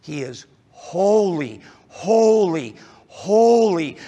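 preacher swelling on holy, holy, holy; emphatic triple holy. Shown as a demonstration. Pastor showing the Hebrew superlative, swelling each holy. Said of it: "The repetition is, is communicating".

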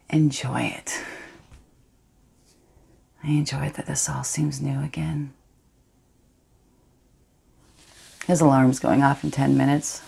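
Astrid, guilty yet thrilled, hushed and intimate; a woman savouring her secret betrayal; defiant confessional monologue. Acted.

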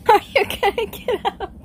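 high, squeaky words squeezed through laughter; laughing too hard to talk properly; helpless laughter. Real, not acted. Woman teasing her stuck friend, helplessly laughing.

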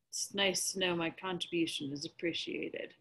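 sarcastic. Acted. Sulky sarcastic remark.

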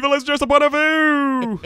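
high loud villain line; hammed-up Sith shout. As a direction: ham up the villain. Quoting Star Wars lines.

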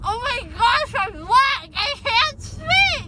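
loud high frantic exclaim. Real, not acted. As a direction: blurt it out loud.

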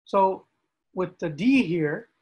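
ordinary delivery

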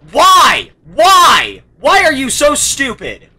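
loud, high-pitched exasperated yelling; angry yelling. Real, not acted. Gamer berating the stupid game character, angry yelling. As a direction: yell at him in real frustration. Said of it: "Stop yelling at him".

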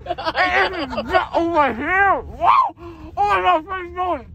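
dazed, laughing, then a startled shout; drugged excitement after surgery; groggy from anesthesia. Real, not acted.

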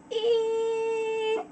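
high, sustained "ee" whine; drawn-out begging whine. Acted. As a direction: hold the high vowel stubbornly. Filly's plea drawn on and on.

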